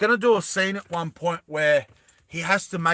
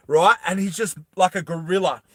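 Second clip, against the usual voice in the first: ordinary talk, nothing marked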